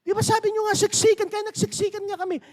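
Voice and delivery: very high, pleading excuse from the players; players protesting. Retold or imitated. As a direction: whine the excuse back at the coach. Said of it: "Sabi niya". (mataas na pagdadahilan ng mga manlalaro)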